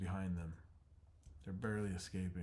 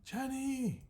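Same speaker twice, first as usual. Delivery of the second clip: loud, very high call; relieved shout; 6 dB louder than usual. Acted. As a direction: yell to catch her attention. Spots her in fire.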